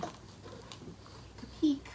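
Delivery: very slow hushed whisper. Real, not acted. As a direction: whisper it as you lift the light. Peeking with flashlight.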